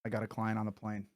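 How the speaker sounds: soft, low-key solemn storytelling; hushed confession. Acted. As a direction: drop to a grave hush.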